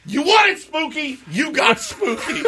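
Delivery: high-pitched, laughing mock triumph; crowing with a laugh breaking in; triumphant retort. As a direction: retell it as a gleeful, high-pitched comeback. Gleeful retort retold, laughing.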